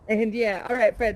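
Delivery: ordinary delivery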